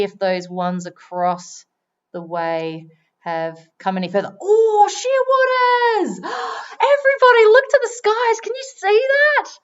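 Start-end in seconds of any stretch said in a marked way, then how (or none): 4.4-9.5 high, loud, thrilled calling out; excited. Real, not acted. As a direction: burst out in delighted wonder. Suddenly spots birds overhead.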